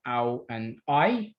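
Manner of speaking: ow and I stretched slowly as gliding vowels; slow diphthong demo. Shown as a demonstration. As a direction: glide slowly through ow and I. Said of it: "some examples of diphthongs are".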